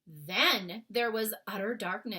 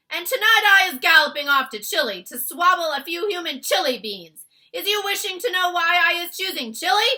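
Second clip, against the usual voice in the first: loud, high bullying giant voice; taunting giant; 9 semitones higher, 16 dB louder than usual. Acted. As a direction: gloat like a brute. Greedy giant taunting the BFG.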